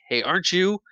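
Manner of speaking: high, loud startled question; surprised recognition. Retold or imitated. Pirate recognizing Peter in the fight, startled high call. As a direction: catch him mid-recognition.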